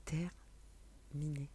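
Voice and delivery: slow whisper of the guides' word; whispered message. Retold or imitated. Whispering what the guides say to viewers. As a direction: let it fall softly, slowly, like a secret.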